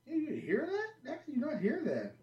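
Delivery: high-pitched incredulous question; re-enacted astonishment, voice shooting up; retold disbelief. Retelling his stunned disbelief to friend.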